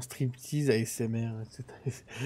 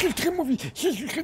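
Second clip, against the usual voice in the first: panicked squeal. Real, not acted. Grabbed by giant hand.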